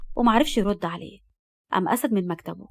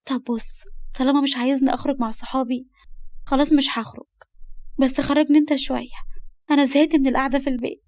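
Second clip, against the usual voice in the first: put-on higher girl's voice, bargaining; pleading young girl; 4 semitones higher than usual. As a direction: coax him in a pitched-up voice. (بصوت بنت بتتحايل)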